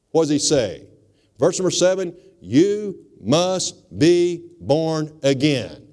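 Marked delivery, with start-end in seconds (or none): 2.4-5.9 each word drawn out slowly; slow and deliberate. Shown as a demonstration. Preacher dictating the key verse, very slowly. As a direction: spell it out slowly so they can write it down. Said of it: "Write that down".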